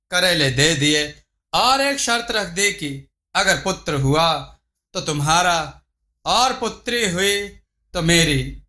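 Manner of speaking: ordinary delivery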